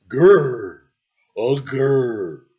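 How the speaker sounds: half-hearted pretend growl; mock growl. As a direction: growl like a dragon who isn't scary. Dragon tries to act tough.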